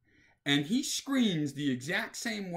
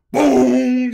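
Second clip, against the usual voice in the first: booming imitated crash, much louder and higher; 9 semitones higher, 18 dB louder than usual. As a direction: slam out the bang of a head on metal. Kid hits head in slide.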